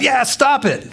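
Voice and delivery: hammed-up stop it; teasing brush-off. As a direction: wave it off playfully. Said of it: "You know".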